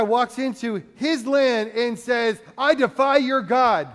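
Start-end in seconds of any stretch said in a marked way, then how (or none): 2.6-3.9 loud, higher and slow; defiant taunt. Retold or imitated. Goliath taunting Israel's army, loud and slow. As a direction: sneer it at the whole army.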